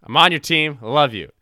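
loud playful shout. Real, not acted. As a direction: call it out loud and cheerful. Shouting affection at Laser Dude.

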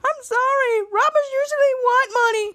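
very high-pitched apologetic squeak; flustered victim voice. Acted. Robbery victim answers the robber.

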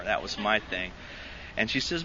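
ordinary talk, nothing marked

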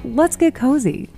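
ordinary delivery

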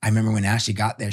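ordinary talk, nothing marked

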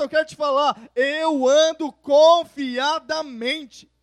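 loud, rising sermon climax; fervent pulpit proclamation; full-force preaching. Acted. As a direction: preach it out to the whole church.

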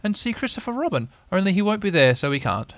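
high little pig voice; Piglet. A narrator's reading. Little pig proposes seeing Christopher Robin, high character voice. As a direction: pipe up as a small storybook pig.

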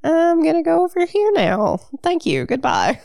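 high, airy voice for a polite exit; high put-on voice. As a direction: chirp a quick polite goodbye. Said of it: "and I'm like".

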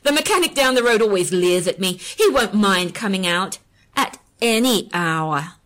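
loud, smug boast with a laugh; confident taunt. A narrator's reading. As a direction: brag to spite him. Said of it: "adding confidently".

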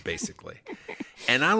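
normal talking voice

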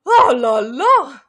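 loud, slow hammed-up oh la la; French chef. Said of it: "this is what I took French for".

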